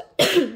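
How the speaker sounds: loud real cough; a sharp real cough mid-sentence. Real, not acted. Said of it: "Pardon, je tousse".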